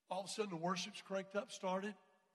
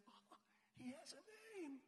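mock surprise; 7 semitones higher, 16 dB quieter than usual. Surprised that guy has name.

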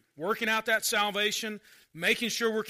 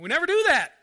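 ordinary delivery

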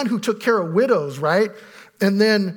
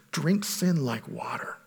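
usual speaking voice

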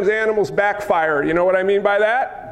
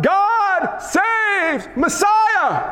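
high, drawn-out desperate shout; crying out; 9 semitones higher, much slower than usual. Acted. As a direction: cry it out high to be heard over a crowd. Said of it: "Bartimaeus cried out".

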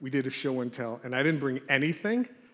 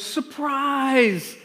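high, loud drawn-out party yell; yelled surprise; 9 semitones higher, 5 dB louder than usual. Retold or imitated. Class greeting their teacher at the door, imitated excited yell. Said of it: "the whole class yells".

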